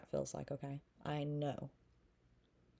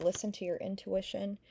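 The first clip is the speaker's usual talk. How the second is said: ordinary delivery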